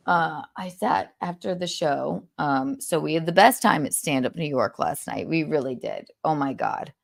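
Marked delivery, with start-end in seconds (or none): none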